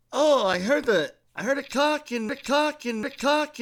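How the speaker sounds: high-pitched, loud, amazed bystander voice; excited bystander. Retold or imitated. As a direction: play the wide-eyed guy who saw it all. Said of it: "He goes".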